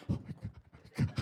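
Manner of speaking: breathless frightened whisper; terrified whisper. Retold or imitated. Comic feeding the bug to the vacuum, whispering in fear. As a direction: whisper it, too scared to move. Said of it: "I'm just like".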